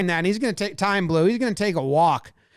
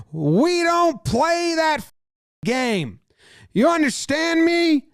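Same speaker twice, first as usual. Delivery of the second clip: high, shouted imitation of the player; hammed-up angry shout, high; mock yelling; 9 semitones higher, slower than usual. Harper shouting at the pitcher: high mock yell.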